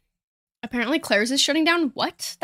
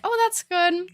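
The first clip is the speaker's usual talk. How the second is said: awkward chirp; 7 semitones higher than usual. Retold or imitated. Retold awkward small talk reply.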